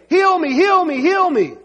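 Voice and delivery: high, insistent repeated plea; begging cry. Acted. Imagined blind man demanding healing, begging cry.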